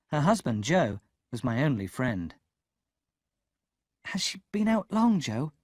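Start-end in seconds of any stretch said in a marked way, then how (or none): 4.1-5.5 nervous. A narrator's reading. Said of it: "I asked nervously".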